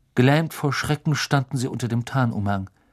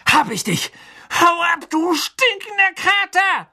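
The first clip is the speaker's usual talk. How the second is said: triumphant angry shout; 5 dB louder than usual. Acted. Ron catching the rat, shouting.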